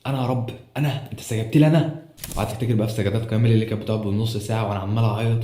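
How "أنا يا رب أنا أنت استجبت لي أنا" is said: retold inner cry of amazed gratitude to God; overwhelmed disbelief. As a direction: relive asking God in wonder, pitch lifting. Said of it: "كل اللي جوايا ساعتها".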